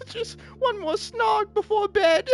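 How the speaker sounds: a squeaky wretch pleading for a kiss; mock pleading. A lad begs a sir for a snog, high whiny mock plea.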